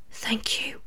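soft, faint thank-you; whispering. A narrator's reading. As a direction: whisper it gratefully. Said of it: "the girl whispered".

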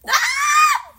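sudden high squeal of frustration; startled yelp. Real, not acted. Host catching her own filler word, sharp high yelp.

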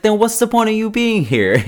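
hammed-up exasperated interrogator; mock exasperation. Roommate interrogating a jobless applicant, exasperated.

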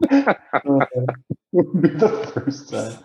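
slow, laughing through the words. Real, not acted. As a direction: repeat it while cracking up. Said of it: "laughs while repeating".

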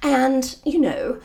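posh English, lightly put on; posh British accent. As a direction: play it like the royals. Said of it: "talking like this".

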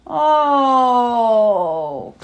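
long, loud, drawn-out "Oh"; monster moan. Acted. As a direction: ham up a spooky wail. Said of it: "cried the monster".

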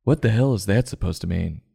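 ordinary delivery

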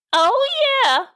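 very high, squeaky oh yeah; agreeing old lady. Acted. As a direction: chime in like a delighted grandma. First retiree agrees.

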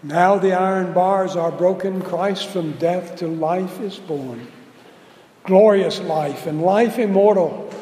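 loud, triumphant poem reading; hymn recital. Acted. Preacher reciting an Easter hymn to the congregation, triumphant. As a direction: declaim it like a victory anthem.

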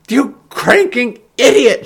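slow, high, furious insult; shouting back. A narrator's reading. Heron insults Ben mid-fall, shouting back. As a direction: yell the insult at him. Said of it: "she shouted back".

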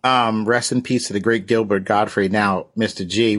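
normal talking voice

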